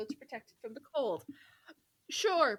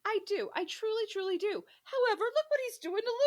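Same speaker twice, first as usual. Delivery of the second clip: earnest protest; 9 semitones higher than usual. Real, not acted. Earnest agreement then protest.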